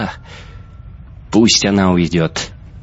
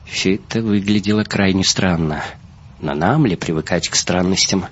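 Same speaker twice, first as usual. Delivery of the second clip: ordinary delivery, nothing marked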